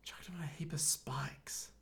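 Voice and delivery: barely voiced whisper; whispered musing. Real, not acted. Co-host trying to remember a scene, whispering. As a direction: whisper it to yourself, puzzling.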